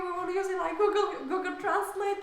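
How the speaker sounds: high, loud and delighted; excited. Real, not acted. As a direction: burst out gleefully, stretching it. Laughing about funny session.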